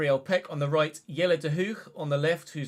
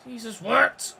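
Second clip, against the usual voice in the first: louder, higher, disbelieving; exasperated outburst; 7 semitones higher, 7 dB louder, slower than usual. Real, not acted. Gamer reacting to a baffling play, exasperated outburst. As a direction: throw it out in angry disbelief.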